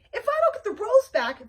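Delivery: high, loud demand; angry shout. Acted. Mimi Kiwi yelling after Flytrap, loud and high. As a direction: yell it after them.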